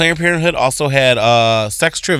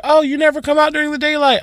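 high mock quote; 13 semitones higher than usual. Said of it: "people were just like".

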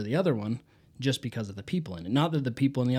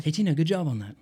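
upbeat coach greeting; 4 semitones higher, faster than usual. Retold or imitated. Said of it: "I just try to say their name".

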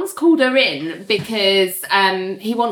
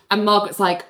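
ordinary talk, nothing marked

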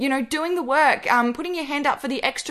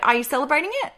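normal talking voice

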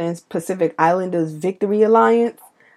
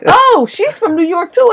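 much higher, louder gushing voice; excited stranger; 11 semitones higher, 5 dB louder than usual. Retold or imitated. Someone abroad hearing she is from New York, gushing. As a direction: gush like a delighted stranger.

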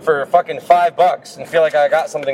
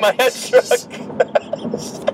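laughing; 9 semitones higher, 4 dB quieter, much slower than usual. Real, not acted. Echoing the quote laughing.

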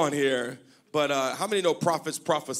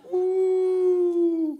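high woo to copy; demo whoop; 14 semitones higher, 4 dB louder than usual. Shown as a demonstration. Pastor shows the church how to shout woo, high whoop. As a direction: show them the woo. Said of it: "Somebody say, "Woo".

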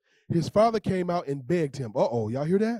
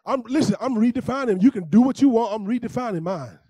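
raised, louder insistent preaching; emphatic declaration; 4 semitones higher, 7 dB louder than usual. Real, not acted. Pastor insisting to the congregation, loud and firm. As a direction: drive it home, defiant.

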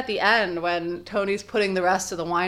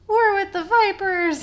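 high put-on Tony voice; lightly imitating Tony; voicing Tony; 6 dB louder than usual. Voicing Tony retelling story.

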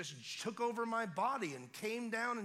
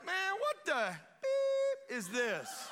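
high, slow mock disbelief; baffled outburst; 12 semitones higher, 7 dB louder, much slower than usual. Bench man baffled by a hundred dollar bill. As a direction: stretch out the confusion.

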